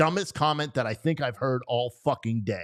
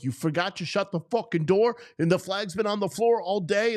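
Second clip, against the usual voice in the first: higher, mock-angry retelling; retold scolding of his wife over the flag; mild retold rant; 8 semitones higher than usual. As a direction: replay the scolding half-heartedly. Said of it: "I flipped out on her".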